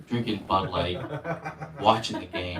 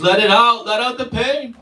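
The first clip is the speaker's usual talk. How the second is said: higher, louder urging; guru urging; 7 semitones higher, 4 dB louder than usual. Retold or imitated. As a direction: coax the pain out like a guru. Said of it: "and he goes".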